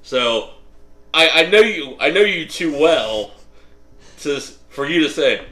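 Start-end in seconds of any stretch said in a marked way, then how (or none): none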